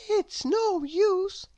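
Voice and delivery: tiny, high, slow voice of despair; despairing little thought. A narrator's reading. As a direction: draw it out in a small, hopeless high voice. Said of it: "with a feeling of despair".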